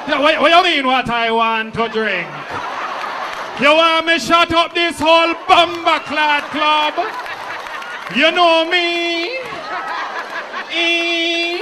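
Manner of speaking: put-on high, loud Jamaican accent, picking a fight. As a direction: shout it in a heavy Jamaican accent. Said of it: "'Cause that's a Jamaican word".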